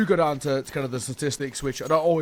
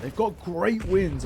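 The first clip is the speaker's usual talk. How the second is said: usual speaking voice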